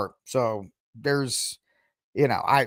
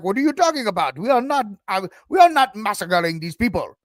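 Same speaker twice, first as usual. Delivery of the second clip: higher-pitched, badly faked African accent; 6 semitones higher, 3 dB louder than usual. Host mimicking Africans denying the accusations, bad African accent. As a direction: put on a clumsy African accent in indignant denial. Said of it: "My African accents are bad".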